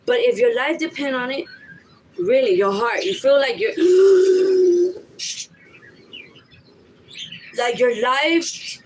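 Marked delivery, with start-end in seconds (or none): 3.8-5.4 sharp loud gasp for air; a startled drowning gasp. Shown as a demonstration. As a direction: gasp like you're being choked. Gasping for breath when attacked.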